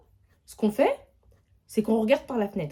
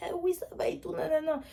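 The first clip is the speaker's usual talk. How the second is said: coughing; 10 semitones higher, 5 dB quieter, faster than usual. Retold or imitated. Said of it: "je commence à tousser".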